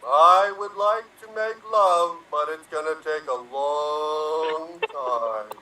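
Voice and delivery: turtle. Acted. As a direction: drag the end out like a turtle. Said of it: "as if you were turtles".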